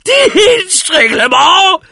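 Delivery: drawn-out, very high scream; loud, stretched cry of scorn; outraged shriek. A narrator's reading. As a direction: screech it slowly in disbelief. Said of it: "schrie der alte Mann".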